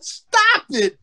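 high-pitched, pleading objection; exasperated protest. Retold or imitated. Embarrassed pitcher telling his teammate to stop, retold exasperated protest. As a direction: beg him to cut it out.

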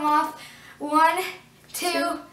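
stiff cheeks, mask on; laughing through face mask; talking in sheet mask. Real, not acted. Seen 1: Wet sheet mask covers the face; lips stay free through the mouth hole.

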